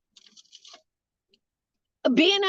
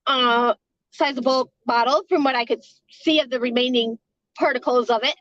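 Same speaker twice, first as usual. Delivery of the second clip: ordinary talk, nothing marked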